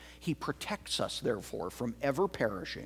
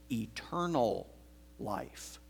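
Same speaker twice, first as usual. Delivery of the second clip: hushed emphasis; 2 semitones lower, 7 dB quieter, slower than usual. Acted. Drops to whisper on life.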